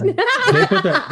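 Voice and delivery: laughing through words. Real, not acted. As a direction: giggle as you read. Laughing while reading email.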